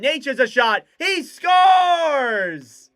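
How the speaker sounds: roaring hockey goal call. Acted. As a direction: explode on the goal like a broadcaster.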